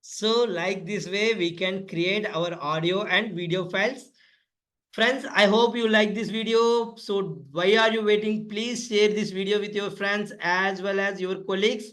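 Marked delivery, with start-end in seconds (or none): none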